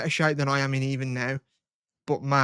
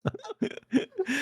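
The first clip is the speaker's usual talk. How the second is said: high laugh; 5 dB quieter than usual. Real, not acted. Laughing at joke.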